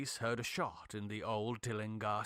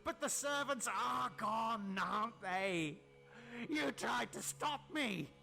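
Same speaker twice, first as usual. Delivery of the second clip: gloating, menacing madman, higher than the narrator; mad scientist; 8 semitones higher than usual. Acted. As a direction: taunt the trapped friend with cruel triumph. Tillinghast gloats and threatens.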